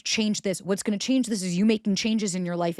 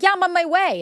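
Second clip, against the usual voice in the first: much higher, louder overeager reply; eager yes; 11 semitones higher, 6 dB louder than usual. Retold or imitated. People pleaser answering a late-night text, eagerly. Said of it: "And you're like".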